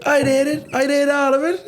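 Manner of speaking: very high mimicked Cork brag; hammed-up high Cork lilt; Cork lad's catcall. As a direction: squeal it at her in a put-on Cork voice. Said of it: "he'd go to her".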